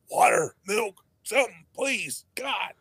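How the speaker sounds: high, strained pleading that climbs to a mock shout; desperate plea for water. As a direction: beg for a drink like your mouth is on fire. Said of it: "I was like".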